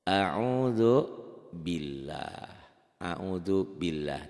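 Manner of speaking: "A'udzubillah" drawn out very slowly at normal pitch; slow and deliberate; slow spoken example. Shown as a demonstration. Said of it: "baris di bawah".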